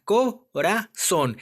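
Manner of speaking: "corazón" very slowly, split as co, ra, zón; syllable by syllable. Shown as a demonstration. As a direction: pause after each syllable of "corazón". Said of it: "vamos a dividir la palabra".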